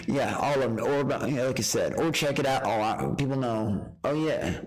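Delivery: usual speaking voice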